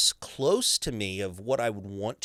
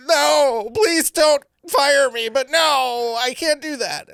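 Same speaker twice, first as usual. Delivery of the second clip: much higher and louder, slow on please; panicked pleading; 10 dB louder, slower than usual. Retold or imitated. Actor refusing a British accent, panicking before the director. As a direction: beg nervously not to be fired. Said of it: "And I went".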